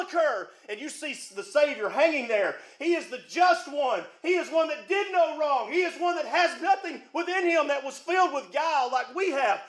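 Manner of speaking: full preacher's cadence, rising on key words; preaching. Acted. Pastor urging his flock to give something up, preaching. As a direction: preach it up to the back pew.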